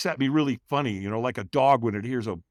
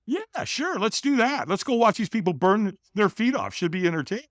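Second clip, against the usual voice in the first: high, mock-willing sarcasm; sarcastic agreement; 8 semitones higher than usual. Hider answers invitation to watch firewalkers, sarcastic agreement. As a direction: feign eagerness, starting very high.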